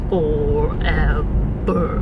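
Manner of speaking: very slow, low, drawn-out movie line; movie quote imitation. Vlogger imitating the actor's slow movie line. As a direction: drag the word out like a close-up whisper. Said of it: "he goes".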